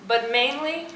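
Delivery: ordinary talk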